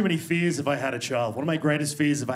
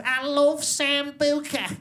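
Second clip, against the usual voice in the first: higher, slow put-on accent voice; Stoke girl's voice; 9 semitones higher, much slower than usual. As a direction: stretch it out, high and dopey. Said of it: "she goes".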